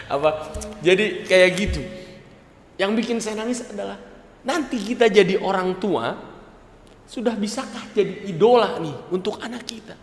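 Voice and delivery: ordinary talk, nothing marked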